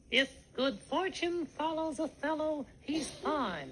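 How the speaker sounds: careful, measured drill, "If" and "fine" with TH; TH for every F. Shown as a demonstration. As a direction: practise "fortune follows a fellow" with TH for each f. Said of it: "E T H instead of F".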